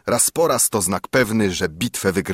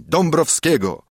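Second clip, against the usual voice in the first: higher-pitched, lightly raised call; mild narrated cry; 6 semitones higher than usual. A narrator's reading. Whole crowd answers as one with a mild cry. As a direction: call the name out, without a full shout. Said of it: "krzyknęli".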